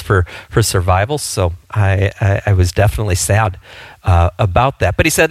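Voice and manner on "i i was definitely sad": usual speaking voice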